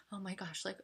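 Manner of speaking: hushed retold despair after a small gasp; quiet mock-panicked gasp, then dejected; sad, self-pitying. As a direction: softly mimic feeling down on yourself. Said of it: "I'd get sad and I'd get down on myself".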